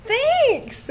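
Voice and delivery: very high, loud thanks; startled thanks. Retold or imitated. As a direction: squeak out a surprised thanks.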